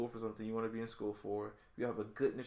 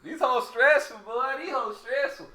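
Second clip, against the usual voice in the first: higher, louder mock catchphrase; put-on street voice; 12 semitones higher, 11 dB louder, slower than usual. Echoing his cohost's joke about girls in a higher put-on voice. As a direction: ham up the complaint like a bit.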